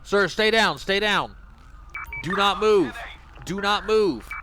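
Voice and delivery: high, loud barked commands; police command shout. Acted. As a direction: order him to stay on the ground. Commanding a downed suspect.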